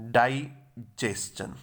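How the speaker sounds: डाइजेशन drawn out slowly as "dai... jes-chan"; sound-by-sound pronunciation. Shown as a demonstration. Teacher breaks down the word, slow careful "dai-jes-chan" for डाइजेशन. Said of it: "ये जे की sound है".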